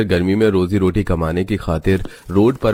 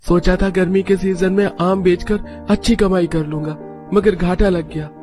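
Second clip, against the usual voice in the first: high-pitched character voice, downcast; disappointed mango seller home from work; dejected husband; 9 semitones higher than usual. Acted. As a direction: tell the family of the loss, disheartened. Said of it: "तीनों के पति मुंह लटकाकर घर आते हैं".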